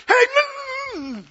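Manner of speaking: high muffled humming after a cut-off "hey"; tongue stuck. Pretend. Driver cut off on the freeway, tongue suddenly stuck. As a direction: start to yell, then only hum helplessly. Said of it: "Your tongue sticks".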